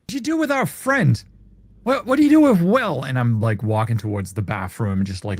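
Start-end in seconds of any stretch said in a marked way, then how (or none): 0.0-3.0 high, loud accusing demand; heated, high-pitched acted accusation; angry confrontation. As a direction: demand answers about a missing friend. Confronts a figure about missing friend.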